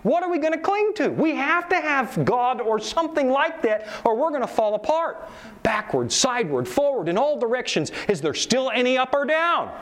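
high, anxious pleading; paraphrased Nietzsche. Retold or imitated. Preacher voicing Nietzsche's panic to the congregation, anxious. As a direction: sound lost and panicked. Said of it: "Nietzsche said".

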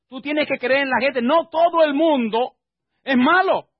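loud, high-pitched husbandly lecture; acted scolding. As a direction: raise the voice to preach at a doubting wife. Acts out scolding his wife.

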